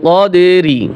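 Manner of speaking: slow, drawn-out tajweed chant; Quran recitation. Shown as a demonstration. Teacher reciting the verse to students, slow melodic chant. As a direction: sing the verse slowly with long vowels.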